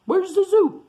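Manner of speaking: high, loud puzzled sloth cry; sloth asking. Retold or imitated. Retelling the ending, sloth asks loudly for the zoo. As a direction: squeak it out like a lost sloth. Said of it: "he's like".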